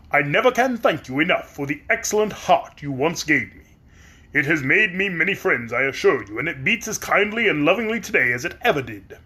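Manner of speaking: metallic grateful tin man; polite Tin Woodman. Acted. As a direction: speak as a kindly tin man.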